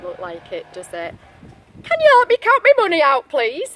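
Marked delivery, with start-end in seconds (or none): 1.8-3.7 high, loud, fast asking; high eager wolf asking politely. Acted. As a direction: rush a hopeful request. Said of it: "he asked politely".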